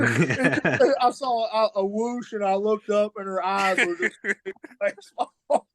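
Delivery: breaking into laughter mid-sentence; giggly, barely holding it together; laughing while talking. Real, not acted. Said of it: "I'm trying not to laugh".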